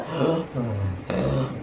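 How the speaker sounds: a soft, strained gasp for air, the dying pupil; imitated dying gasp. Preacher imitating his dying pupil's gasp. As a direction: catch a weak breath like someone dying. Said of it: "Dikira kesurupan karena gini".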